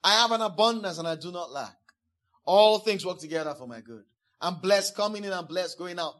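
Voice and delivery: loud, firm declaration; confession declared aloud. Shown as a demonstration. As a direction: proclaim it like a faith confession. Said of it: "Then say it".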